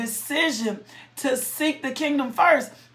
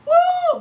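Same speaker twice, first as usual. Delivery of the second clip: laughing whoop; 5 dB louder than usual. Real, not acted. Preacher thankful not left a mess, laughs and whoops high. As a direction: laugh then a high joyful cry.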